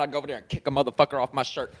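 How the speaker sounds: ordinary talk, nothing marked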